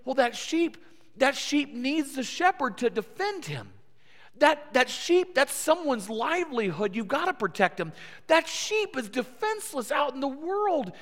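the crowd insisting the sheep needs saving; sympathetic crowd. Retold or imitated. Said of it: "they say".

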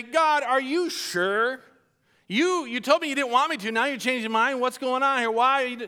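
lightly hammed-up worried asking; mock pleading. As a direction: play the hesitant prophet half-seriously. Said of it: "you didn't even say".

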